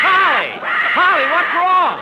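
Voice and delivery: high, loud alarmed call to the bird; startled yell at the squawking parrot; alarmed shout. Acted.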